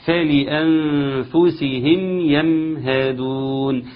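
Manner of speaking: slow measured Quranic recitation. Shown as a demonstration. Teacher reciting the next verse, slow. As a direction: recite each letter clearly. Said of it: "نقطتين".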